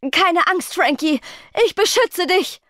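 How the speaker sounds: heroic bellow. A narrator's reading. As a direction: charge in to the rescue. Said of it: "brüllte Hudud". (laut gebrüllt, heldenhaft)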